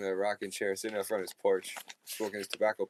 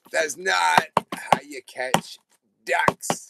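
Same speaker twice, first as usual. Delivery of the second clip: put-on stern old man; 3 semitones higher, 9 dB louder, slower than usual. Old man scolding the boy.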